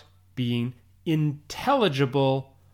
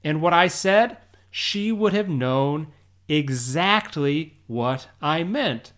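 usual speaking voice

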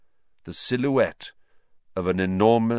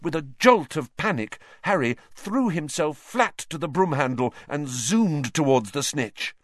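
usual speaking voice

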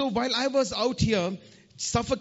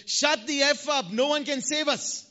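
retold shout; 7 semitones higher than usual. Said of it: "he shouted at me".

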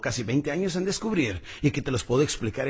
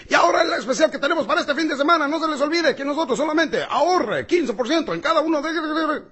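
agudo, rápido y fuerte, sin pausa; anunciador de comercial; 13 semitones higher, 5 dB louder than usual. Retold or imitated. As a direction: suelta la oferta a toda velocidad. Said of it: "a veces salen los anunciadores". (imitación de anunciador de televisión acelerado)

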